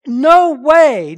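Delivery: loud, high, ringing No way; very emphatic. Shown as a demonstration. Preacher shouting Paul's answer to the congregation, emphatic. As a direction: slam it down with full force. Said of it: "No, it's very emphatic".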